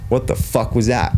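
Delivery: slow, controlled retold challenge; aggressive confrontation. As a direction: confront the coach, restrained but cross. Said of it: "I was a little bit more aggressive".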